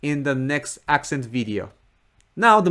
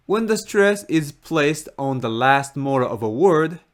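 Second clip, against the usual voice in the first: usual speaking voice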